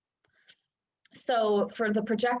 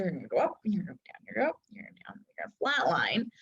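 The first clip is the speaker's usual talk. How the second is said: softer each phrase until only breath remains; fading to a whisper; 3 semitones lower, 4 dB quieter, much faster than usual. Shown as a demonstration. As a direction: trail down from soft to whispered. Acting out the emotional wave.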